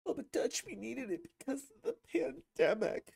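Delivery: very high, thin mocking voice; whiny mock objector. Objector pleading pandemic excuses to the host, whiny mockery. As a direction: squeal it like a feeble excuse.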